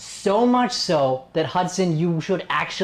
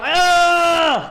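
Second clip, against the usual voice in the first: long, much higher, much louder ah shout; full-throated yell; 13 semitones higher, 7 dB louder than usual. Shown as a demonstration. Coach pumps up the student with a long loud yell. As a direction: let it all out in one long scream.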